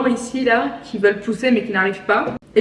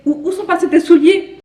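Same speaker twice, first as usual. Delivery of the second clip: playful Québécois imitation, pitched higher; Québécois accent; 6 semitones higher, 4 dB louder than usual. Imitating a Québécois asking about shoes, playful Québécois accent. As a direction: put on a lilting Québécois accent. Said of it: "Un Québécois qui te parle français".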